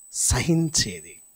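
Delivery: very slow, low and held; slow gentle word. Real, not acted. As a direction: draw it out tenderly. Preacher slows on virtue. (నెమ్మదిగా సాగదీసి)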